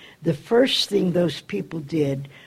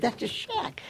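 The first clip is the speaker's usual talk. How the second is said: mock anger; 11 semitones higher, 6 dB quieter than usual. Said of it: "He was angry".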